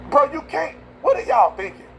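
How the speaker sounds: a high, exasperated plea to his own party. Real, not acted. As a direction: throw your hands up with your voice. Exasperated at Republicans' pick.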